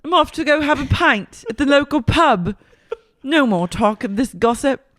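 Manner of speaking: cartoon British exit speech; mock British. Co-host playing Meredith leaving for pub, mock British accent. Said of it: "goes back into a British accent".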